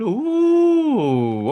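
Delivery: long, high, loud "ooh"; drawn-out high whoop of admiration; impressed ooh. Real, not acted. Streamer admiring a Land Cruiser, impressed ooh.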